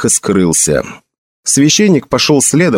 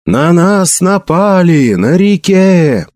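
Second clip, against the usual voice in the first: high, drawn-out warning cry; yelled alarm; 6 semitones higher, much slower than usual. A narrator's reading. Priest warns the sleeping camp of attack, yelling. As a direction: call it slowly across the camp. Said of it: "заорал он так сильно".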